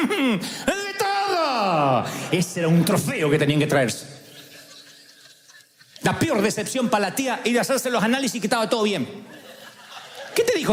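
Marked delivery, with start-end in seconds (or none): 0.1-2.0 triumphant aunt. Retold or imitated. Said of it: "aunt's proud answer". 10.3-10.7 much higher eager question; curious asker. Retold or imitated. A relative asking the aunt about her results, high and eager.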